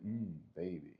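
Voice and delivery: low, soft, slow romantic purr; hammed-up sexy voice, hushed and low; mock seductive. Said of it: "his voice maybe sounds very good".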